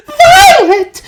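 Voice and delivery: very high, loud shriek of a name; mother screaming at her daughter; Mrs Beauregard screaming. A narrator's reading. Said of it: "screamed Missus Beauregard".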